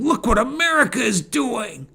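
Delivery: high-pitched mock alarm; mock quaking fear. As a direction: pretend to be generals quaking at the news. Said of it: "quaking in their boots saying".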